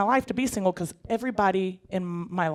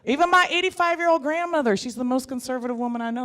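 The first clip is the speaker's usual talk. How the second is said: ordinary talk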